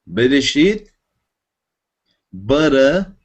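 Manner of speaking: Bereshit with Israeli r, Israeli accent; Israeli pronunciation. Shown as a demonstration. Teacher switching to Israeli Hebrew pronunciation for class. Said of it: "vamos usar a pronúncia israelense".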